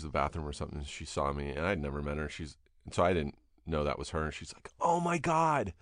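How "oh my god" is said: high gushing Oh my God; excited gush. Retold or imitated. Casting director meeting a fan, gushing.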